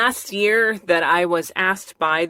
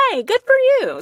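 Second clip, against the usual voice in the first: high excited quote; 5 dB louder than usual. Retold or imitated. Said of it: "would have been like".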